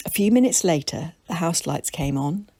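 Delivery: plain reading voice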